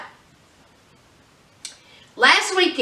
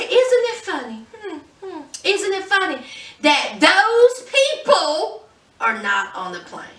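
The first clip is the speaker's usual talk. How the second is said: mock snide sing-song; 5 semitones higher than usual. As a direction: needle them with a sly high voice. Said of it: "She said".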